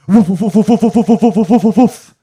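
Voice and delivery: loud, high yappy woofs; dog barking. Retold or imitated. Gnome in the dream barking at the comic, loud high woofs. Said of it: "barking at me like a dog".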